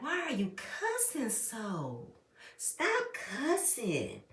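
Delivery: soft, slow, drawn-out mock scolding; quiet, long-vowelled plea, put on; imitated pleading aunt. Retelling Aunt Bertha objecting to cussing, in a soft plea.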